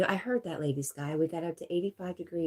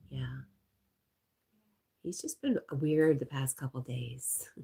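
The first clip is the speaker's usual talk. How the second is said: normal talking voice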